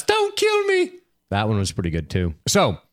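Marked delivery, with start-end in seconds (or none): 0.0-0.9 mock-terrified squeal, very high; 1 squealing coward. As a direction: shriek it like a cornered coward. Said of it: "he's like".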